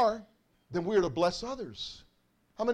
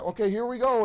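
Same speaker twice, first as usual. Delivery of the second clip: normal talking voice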